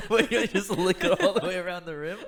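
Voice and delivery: loud, high-pitched laughing question. Real, not acted. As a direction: ask it through a burst of laughter. Laughing at friend's sugar mistake.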